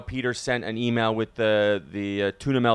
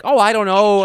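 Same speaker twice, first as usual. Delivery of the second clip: flustered fumbling; 9 semitones higher, 10 dB louder than usual. Retold or imitated. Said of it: "I'm like".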